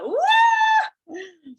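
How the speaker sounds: very high, loud cheer; joyful shout of delight; excited whoop. Pretend. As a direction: whoop like a fan at a concert. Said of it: "you'll be like".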